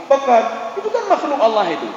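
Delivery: says it through a laugh; amused laughing aside. Real, not acted. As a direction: chuckle while explaining. Laugh heard.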